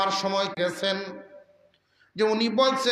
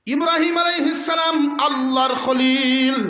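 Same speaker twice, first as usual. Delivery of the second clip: high, loud preaching voice; raised, ringing sermon delivery; fervent proclamation; 6 semitones higher, 5 dB louder than usual. Real, not acted. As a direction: proclaim the prophet's coming at full voice. Preacher exalts Abraham's arrival.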